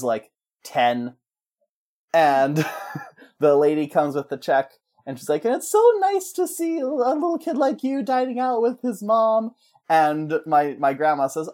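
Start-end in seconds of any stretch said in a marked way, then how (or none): 5.4-9.5 put-on high, sweet talk to a kid; imitated high-pitched server; gushing waitress. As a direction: coo it like a friendly waitress. Waitress cooing at a child.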